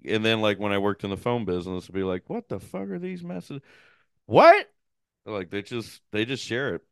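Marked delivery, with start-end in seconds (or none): 2.3-4.7 high, hushed disbelief rising to a louder What; baffled. Retold or imitated. Reacting to messages in the phone business, high-pitched bafflement. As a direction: squeak out your bewilderment.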